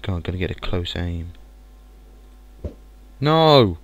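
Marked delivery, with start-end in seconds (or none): 3.2-3.8 loud dismayed cry. Real, not acted. Snowball stolen.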